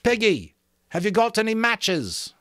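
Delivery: high, loud call across the mountain; shouting. A narrator's reading. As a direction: call out to him from afar. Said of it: "Ralph shouted at him".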